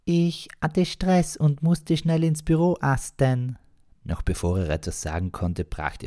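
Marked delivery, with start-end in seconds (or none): 0.1-3.6 put-on very high, slow girlish voice, a little sheepish; sheepish young girlfriend. Girlfriend excusing herself on the phone, very high, slow and sheepish. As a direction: squeak it out sheepishly in a high girl's voice. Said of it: "Leicht beschämt meinte sie nur".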